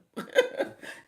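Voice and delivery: a burst of unvoiced laughter; real breathy chuckle. Real, not acted. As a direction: laugh it off, amused. Laughs at own joke.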